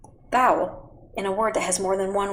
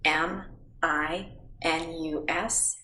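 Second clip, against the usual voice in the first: slow spelling; 5 dB quieter, much slower than usual. Shown as a demonstration. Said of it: "Now throw it back to me".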